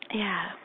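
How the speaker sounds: long airy yawn trailing off; breathy demo yawn. Shown as a demonstration. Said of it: "one more".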